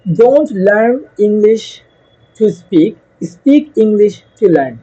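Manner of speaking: slow, deliberate motto, word by word; slow emphatic slogan. Shown as a demonstration. Teacher to students, spelling out the course motto slowly. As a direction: let each word land slowly.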